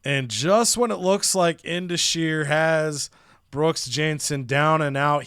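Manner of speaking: ordinary talk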